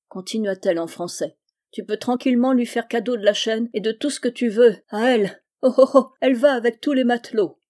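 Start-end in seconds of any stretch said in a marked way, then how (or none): 1.7-7.6 louder, higher scornful taunt; jealous spite. Acted. Jealous girl slandering Yvonne to her lover, spiteful. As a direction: sneer at the rival with a mocking laugh.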